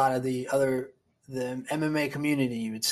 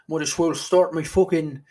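put-on Irish accent; 3 semitones higher, 8 dB louder than usual.

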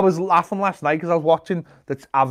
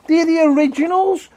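higher, louder indignant insisting; indignant outburst; 10 semitones higher, 4 dB louder than usual. Real, not acted. Arguing with his co-host that McVitie's are the originals, worked up and loud. Heated biscuit-brand protest.

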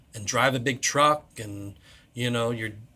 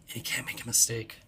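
whisper; 10 dB quieter than usual. Real, not acted. Dismay over the crying boy.

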